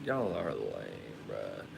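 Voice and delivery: dropped way low and slow, teasing viewers; a low, slow mock jab at viewers; deep mock put-down. Real, not acted. Teasing viewers who left, in a deep slow put-down voice. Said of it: "mocking viewers who left".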